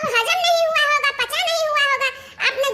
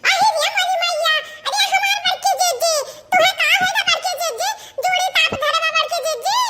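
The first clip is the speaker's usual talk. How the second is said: higher shouting with a laugh; 4 semitones higher than usual. Acted. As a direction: call out to the big sister. Sister calling out loudly. (बड़की जीजी को ज़ोर से हँसते हुए पुकारना)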